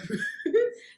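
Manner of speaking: high, loud burst of laughter; real laugh. Real, not acted. Laugh at mom finding Barb.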